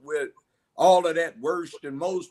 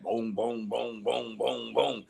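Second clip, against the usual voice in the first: low, softer, steady run of booms; imitated booming; 3 semitones lower, 7 dB quieter than usual. Man showing police lights hitting his apartment, low booming. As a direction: voice the lights banging on one by one.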